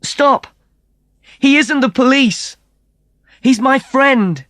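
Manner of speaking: high, loud pleading cry; slowing on the last words; desperate shout. Acted. Cry out to save him.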